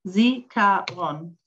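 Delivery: "Zikaron" slowly in separated syllables; syllable-by-syllable pronunciation. Shown as a demonstration. Teacher answering a student's spelling question, "Zikaron" syllable by syllable. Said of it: "what is the English transliteration".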